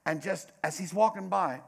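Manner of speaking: ordinary talk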